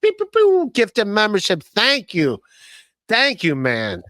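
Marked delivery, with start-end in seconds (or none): 0.0-0.7 laser noises. Pretend.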